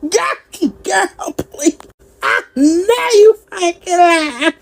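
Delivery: high, teary mock of her crying; a touch of whiny fake crying. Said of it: "get choked up and start crying".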